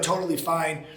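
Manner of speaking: normal talking voice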